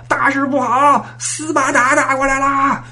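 high, slow alarmed cry; alarm shout. Retold or imitated. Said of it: "探子来报".

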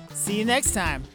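ordinary delivery

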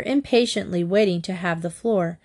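ordinary delivery, nothing marked

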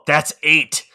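first push of the movie line; punchy recited quote. Retold or imitated.

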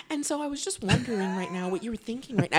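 high-pitched put-on voice; up an octave. Shown as a demonstration. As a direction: mimic someone an octave above your own voice. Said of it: "goes up an octave".

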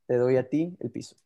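usual speaking voice

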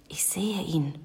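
hushed, secretive whisper; soft conspiratorial whisper. A narrator's reading. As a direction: whisper it softly so nobody overhears. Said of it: "flüsterte die kleine Hexe".

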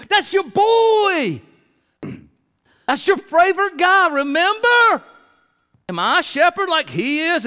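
high, loud mocking sarcasm; sarcastic Cain. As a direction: taunt God with bitter scorn. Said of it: "I hear him saying this".

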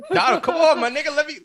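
squeaking through the giggles; pleading and cracking up; high-pitched, laughing plea. Real, not acted. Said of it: "Don't make me laugh".

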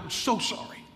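soft whispered apology; hushed confession. Acted. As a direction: breathe it out contritely. Penitent prayer voiced softly.

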